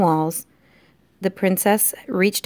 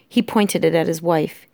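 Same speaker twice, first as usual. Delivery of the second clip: usual speaking voice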